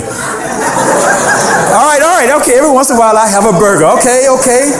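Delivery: high-pitched, laughing admission; mock-defensive confession. Preacher admits burger habit to congregation, mock-defensive and amused.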